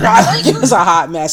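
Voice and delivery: normal talking voice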